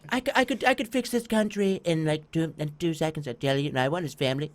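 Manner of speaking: loud bragging Trump voice; Donald Trump impression. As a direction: boast like a politician. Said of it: "why does Donald Trump have the same voice".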